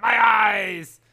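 high, loud hammed-up wail; mock agonized shout. Joking as the victim when things go horribly wrong, mock agony.

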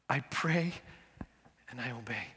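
put-on soft, low repeat in a mild Korean accent. Preacher repeats the pastor's answer, soft Korean accent. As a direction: echo the pastor's Korean accent quietly, savoring it. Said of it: "his Korean accent".

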